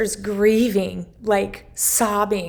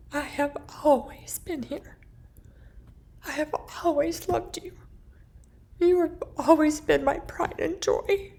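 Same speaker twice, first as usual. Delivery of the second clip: tender, emotional; 8 semitones higher than usual. Retold or imitated. Mother speaking through the book to her daughter, tender and higher. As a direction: speak gently, full of love. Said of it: "to my sister".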